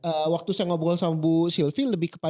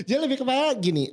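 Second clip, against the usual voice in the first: laughing; 7 semitones higher, faster than usual. Real, not acted. Laughing over starting a mukbang.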